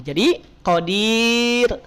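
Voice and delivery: "qadir" said slowly with a thin ro; slow, careful "qadir" with a flat tongue root. Shown as a demonstration. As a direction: stretch "qadir" and keep the ro light. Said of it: "roknya tipis".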